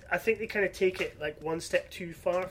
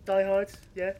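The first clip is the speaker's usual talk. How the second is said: smug; 3 semitones higher, 5 dB louder than usual. Acted.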